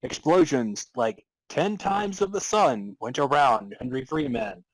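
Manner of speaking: normal talking voice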